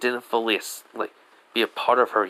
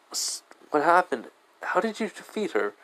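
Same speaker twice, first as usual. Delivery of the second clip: higher-pitched, amazed questioning, acted out; retold astonishment; 7 semitones higher than usual. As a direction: voice a character baffled by the victory.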